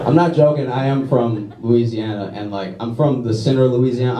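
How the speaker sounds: ordinary talk, nothing marked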